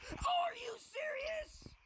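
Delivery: very high, slow strained scream; enraged shriek. Real, not acted. Gamer reacting to lag, enraged shriek. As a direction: screech it slowly in total disbelief.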